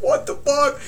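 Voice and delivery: high-pitched re-enacted alarm; high, bewildered exclamation; startled on waking. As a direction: act out waking up in disbelief. Acted reaction on waking to scream.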